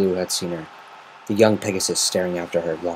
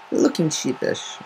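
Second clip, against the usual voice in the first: usual speaking voice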